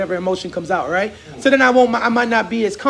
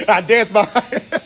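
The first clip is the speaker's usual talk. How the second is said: usual speaking voice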